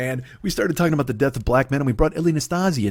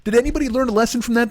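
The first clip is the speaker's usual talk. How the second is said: mock beaten-up townsman; 8 semitones higher than usual. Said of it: "they're just like".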